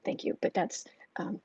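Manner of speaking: soft voiceless thank you; whispered thanks. Real, not acted. Host thanks a viewer for crediting her prediction, whispering. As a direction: barely breathe the thanks.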